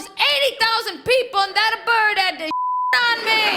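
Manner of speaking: high, outraged grandpa, laughing at the end; indignant old man; grandfather. Retold or imitated. Grandfather complaining about the bird, high and outraged. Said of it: "He goes".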